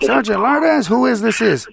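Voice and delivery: loud, high-pitched mock questioning; comic movie-quote outburst; Cheech. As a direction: pitch it way up, taunting the officer. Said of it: "What did Cheech say?".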